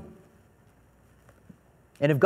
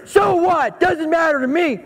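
higher, louder bratty comeback; mock sassy child; defiant kid; 11 semitones higher, 4 dB louder, slower than usual. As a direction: shrug it off like a stubborn seven-year-old. Defiant son answers back.

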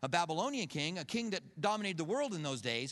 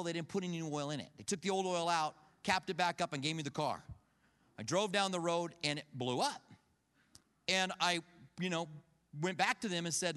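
normal talking voice

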